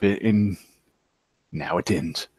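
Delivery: hushed, low and soft threat; menacing whisper. Acted. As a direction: murmur it low into his ear. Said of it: "whispering something into my ear".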